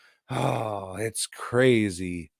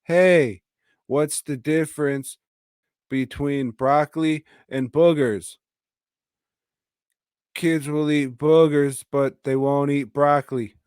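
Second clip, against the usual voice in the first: put-on a higher, louder stilted kid line read; mocking bad child actor; 5 semitones higher, 5 dB louder than usual. Reviewer mocking the orphan kid's awful joke, stilted and high. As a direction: ham up the worst line delivery ever. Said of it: "he talks like this".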